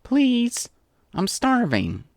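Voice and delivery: high, drawn "please", whiny and needy; pleading. A narrator's reading. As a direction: beg as if famished. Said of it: "Rainbow pleaded".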